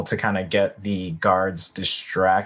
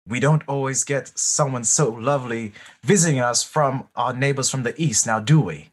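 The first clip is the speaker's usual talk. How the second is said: put-on amateur British accent, slightly raised; worst British accent; 3 semitones higher than usual. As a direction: coax the guard in mock-British tones. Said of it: "put on my worst British accent".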